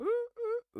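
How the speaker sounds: high ooh squeal, way up; a quick high-pitched ooh imitation; imitated ooh. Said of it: "sounds like the same tone of Bond".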